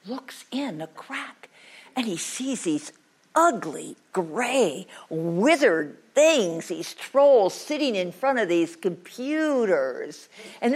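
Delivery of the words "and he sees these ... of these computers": ordinary delivery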